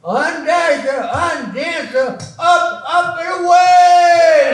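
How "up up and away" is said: loud, higher heroic shout. Acted. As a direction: shout it like a takeoff cry. Flying off, shouting.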